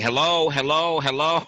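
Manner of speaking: pretending he can't hear, calling hello; mock faked bad connection. Imitating Mike faking a dropped call to dodge the question, high. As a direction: fake hello into a dead line, high. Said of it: "he's faking".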